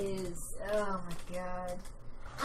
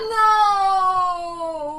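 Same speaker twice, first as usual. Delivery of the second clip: long, high, loud "no" into a wail; high, drawn-out cry of comic despair; mock despair; 8 semitones higher, 11 dB louder than usual. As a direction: wail it like a hammed-up tragedy. Mock despair over slow loading program.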